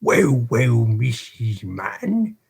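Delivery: lightly voiced beadle, slow and settling down; cool off and speak evenly; a hint of a calmed British beadle; calmer tone. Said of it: "he replied in a calmer tone".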